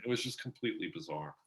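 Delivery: ordinary talk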